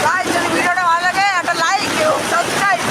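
high, loud and eager; excited loud outro. Real, not acted. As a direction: shout the sign-off excitedly. Loud outro before cold bath.

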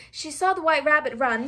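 plain reading voice

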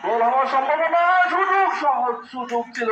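high, loud chance call; excited commentary. Real, not acted. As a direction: lift the voice as the chance builds. (উত্তেজিত ধারাভাষ্য)

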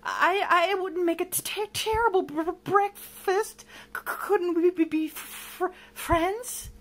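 frightened stammer. Acted. Monster begging villain for friendship, stammering. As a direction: trip over the words in fright. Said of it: "Lamont stammered".

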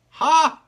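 a sudden high bark of a laugh; sharp single ha; loud laugh. Real, not acted. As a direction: burst out laughing. Punchline laugh after haiku.

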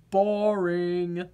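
low, glum potato voice; bored potato. Acted. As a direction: sound like a vegetable with no fun left.

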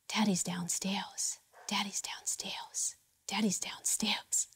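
slow, soft unvoiced whisper, creepy and repeated; eerie whisper. Toddler whispering into mom's ear at bedtime, eerie whisper. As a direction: breathe it like a haunted child in a horror film. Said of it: "she begins to whisper in my ear".

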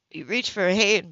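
ordinary delivery, nothing marked

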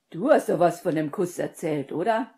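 loud, insistent questioning; exasperated, forceful query; confused challenge. A narrator's reading. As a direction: press her loudly, lifting on the last word. Agitated friend challenges her.

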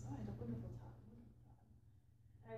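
soft, fading attempt at Deepak Chopra's Indian accent; a self-admittedly poor Indian accent, dropping to near-whisper. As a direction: imitate Deepak Chopra's Indian accent, trailing into a breathy hush. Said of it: "in his very Deepak way, which I'm terrible at the accent".

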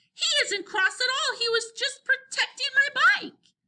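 high-pitched, heated insistence. A narrator's reading. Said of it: "Henry was growing more excited".

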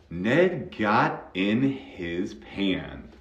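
very slow, word-by-word model sentence; model reading. Shown as a demonstration. Teacher reads the next practice line, very slow model reading. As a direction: spell the line out slowly for learners. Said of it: "All right".

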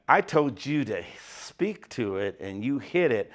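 low, soft, rebuking God; stern God voice. Retold or imitated. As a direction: speak as God, low and quietly reproachful. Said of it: "and God said".

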